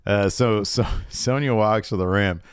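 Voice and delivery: normal talking voice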